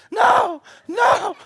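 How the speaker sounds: high, loud no, hammed up; mock anguished shout. Preacher acting out refusing his daddy, mock high shout. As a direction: wail it like a kid refusing. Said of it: "I was like this right here".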